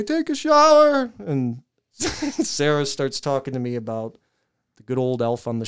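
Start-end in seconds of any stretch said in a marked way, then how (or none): 0.0-1.1 high, strained nervous squeak; trying to sound normal while high; shaky voice. Retold or imitated. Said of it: "and I try to say".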